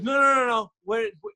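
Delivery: high, rapid-fire imitated objection; stern interruption. Stern producer stopping the actor mid-excuse, imitated sharp interruption. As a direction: cut in sharply, as a mock stern boss. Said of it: "stern guy".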